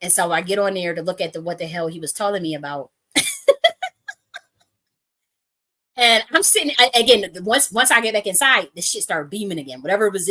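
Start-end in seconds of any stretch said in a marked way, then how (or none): 3.1-4.1 very high, loud laugh. Real, not acted. Storyteller recalling his orders about her tweets, high laughter. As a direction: burst out laughing mid-story. Laughs at what she was told.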